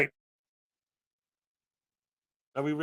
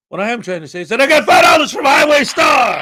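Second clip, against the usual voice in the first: announcer shout; 3 semitones higher, 10 dB louder, faster than usual. Real, not acted. Announcing a donation.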